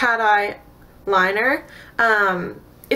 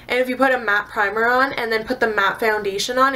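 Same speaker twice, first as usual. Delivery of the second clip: ordinary talk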